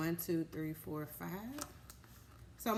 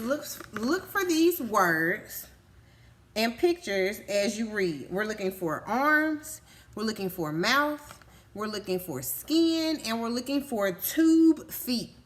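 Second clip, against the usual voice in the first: put-on slow, louder read-aloud voice for kids; reading to children; 4 dB louder than usual. Teacher reading the word list to her class, slow and emphatic. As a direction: read it out big and clear for little listeners.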